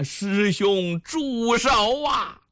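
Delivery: a plea cried across the clearing; shouted plea to stop. Acted. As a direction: call out to hold his hand, stretching it. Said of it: "大喊了一声啊".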